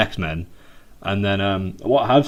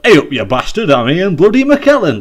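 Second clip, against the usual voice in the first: broad mock northern Wigan voice, louder and higher; northern English accent; 7 semitones higher, 7 dB louder than usual. Podcaster imitating the actor's native northern accent, broad and loud. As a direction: ham up a blunt northern lad from Wigan. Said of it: "his real sort of native accent is like".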